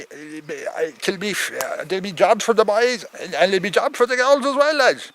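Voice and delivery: ordinary talk, nothing marked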